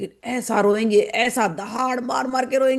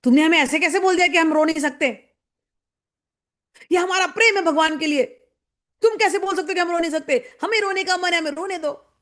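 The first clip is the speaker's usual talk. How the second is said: mock offended outrage; 6 semitones higher than usual. Hurt-ego devotee snapping at the one who calmed him. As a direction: bristle at being told to stop. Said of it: "तब उनके अहंकार को चोट लग जाएगा".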